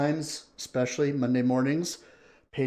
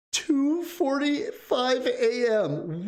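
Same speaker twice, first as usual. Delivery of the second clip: "Two forty-five A M" drawn out slow and much higher; surprised; 10 semitones higher, much slower than usual. Real, not acted. Streamer reads a viewer's time aloud, slow and amazed. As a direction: marvel at how late it is, slowly. Reacting to a viewer's late hour.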